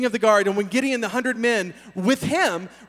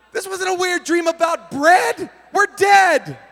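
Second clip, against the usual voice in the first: panicked; 8 semitones higher, 6 dB louder, slower than usual. Retold or imitated. Panicked Midianite friend.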